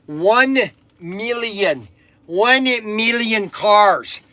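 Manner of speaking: drawn-out, slow emphasis on each word; emphatic announcement. Real, not acted. Host stressing how many cars were made, slow and emphatic. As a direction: hammer it home slowly and grandly.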